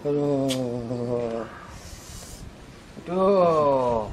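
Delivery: weak groaning. Acted.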